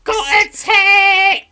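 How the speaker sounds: very high, loud, slow excited shriek; mock Love Island contestant. Joining the mockery of contestants, high excited shriek. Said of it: "when they all go".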